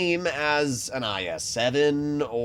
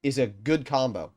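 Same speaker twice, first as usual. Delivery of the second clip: normal talking voice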